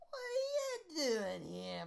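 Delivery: high, loud, drawn-out British voice; hammed-up British accent, sharp and slow; sharp British-accented mother. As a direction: demand it in an exaggerated British accent. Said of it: "Her tone is sharp and betrays her disappointment".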